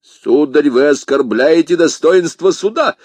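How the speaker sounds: loud, high, indignant; outraged rebuke. Acted. Commissar scolds Athos for insulting the court, outraged. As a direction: thunder in offended authority.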